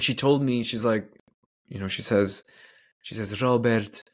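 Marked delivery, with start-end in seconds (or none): 3.4-4.0 put-on light Polish-accented address. Mother addressing her son, in a light Polish accent. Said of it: "my best Polish accent here".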